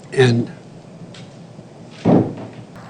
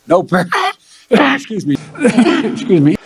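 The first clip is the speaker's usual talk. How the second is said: laughing through coughs; 9 semitones higher than usual. Real, not acted. Coughing mid speech, laughing.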